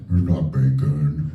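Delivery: low, slow, flat-voiced remark; a hint of the guy's dry verdict; mock guy's deadpan. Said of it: "he was like".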